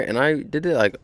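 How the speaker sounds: ordinary talk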